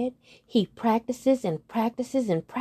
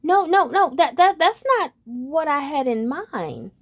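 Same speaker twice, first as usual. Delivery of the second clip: high, loud flustered protest; frog protesting; 7 semitones higher, 5 dB louder than usual. Acted. Frog refusing the worm job, high and loud. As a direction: blurt it out. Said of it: "said Frog".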